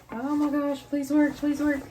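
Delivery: high, urgent little plea; anxious pleading. Real, not acted. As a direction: beg the thing to fit. Pleading while stuffing toy into bag.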